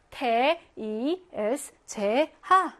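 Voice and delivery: letters read one by one; spelling out. Shown as a demonstration. Teacher spells a word out for students, letter by letter. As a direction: separate each letter with a small gap. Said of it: "이렇게 하나하나 읽어주면 돼".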